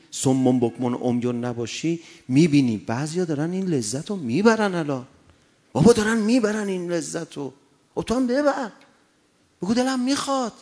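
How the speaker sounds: loud sermon pushed hard; full-force preaching. Acted. Preacher urging the congregation toward faith, loud and insistent. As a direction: drive the point home from the pulpit.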